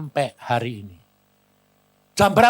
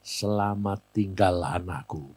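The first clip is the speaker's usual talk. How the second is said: low, slow, soft goodbye; dying mother's farewell; 6 semitones lower, 5 dB quieter, slower than usual. Retold or imitated. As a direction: imitate a departing mother's gentle parting. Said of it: "dia bilang gini". (perpisahan ibu, rendah dan lambat)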